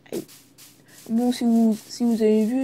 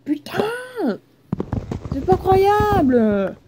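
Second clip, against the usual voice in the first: loud, high, slow disbelieving cry; exasperated shout; 9 semitones higher, 3 dB louder, much slower than usual. Real, not acted. Gamer raging at his own fall, loud and stretched. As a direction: wail it out in disbelief. Falls again in game.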